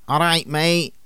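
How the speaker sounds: lad's Manc greeting; Manc accent. Shown as a demonstration. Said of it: "listen to their equivalents in Mank dialect".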